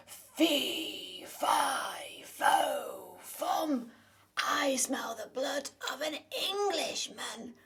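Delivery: big loud giant voice, slow and menacing; booming giant. Acted. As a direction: roar it like a hungry giant. Giant enters, child gets scared.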